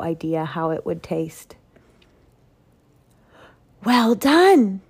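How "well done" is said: pleased Duke. Acted. Duke praises the pastry.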